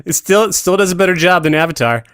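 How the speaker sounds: ordinary talk, nothing marked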